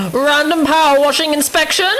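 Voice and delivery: high gasping question; gasp, then ask in exaggerated surprise; mock shocked. Quotes show, acted surprise.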